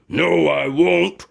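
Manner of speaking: a hint of a slow bear voice; calm her down gently; bear reassuring. Bear under the tree, lightly voiced and slow. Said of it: "said the bear".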